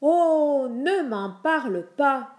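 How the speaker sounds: loud, high, long-drawn groaning drawl. A narrator's reading. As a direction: drag out the words in weary complaint. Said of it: "de sa voix traînante". (voix forte et traînante)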